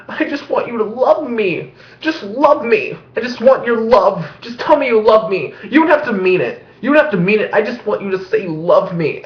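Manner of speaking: put-on high, whiny pleading girlfriend; clingy girlfriend. Vlogger mimics clingy high school girlfriends, high and whiny. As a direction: beg like a needy teen. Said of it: "it's like".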